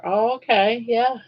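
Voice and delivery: ordinary talk, nothing marked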